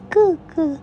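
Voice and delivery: put-on high sing-song baby talk; cooing goo-goo baby talk. Mother baby-talking to infant.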